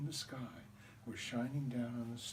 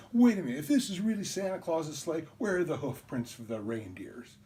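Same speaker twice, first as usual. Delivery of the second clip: higher and much louder, triumphant; excited objection; 4 semitones higher, 7 dB louder than usual. Retold or imitated. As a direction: pounce on the flaw in his story. Kid catching brother's lie.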